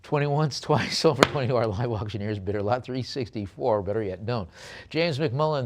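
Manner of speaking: usual speaking voice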